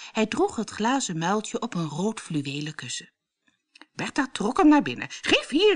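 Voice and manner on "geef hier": high, louder snatching shout; a much higher, louder grab; grabbing cry. A narrator's reading. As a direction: demand it greedily. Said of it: "riep ze".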